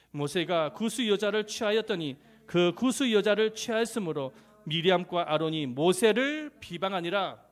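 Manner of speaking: loud recited Bible verse; scripture reading aloud. Acted. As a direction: lead the congregation in reading scripture, loudly.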